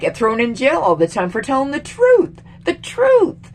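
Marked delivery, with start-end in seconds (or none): 1.8-3.5 high, loud insistence on "The truth."; emphatic outcry. Real, not acted. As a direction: hammer the point home. Ranting about press jailings.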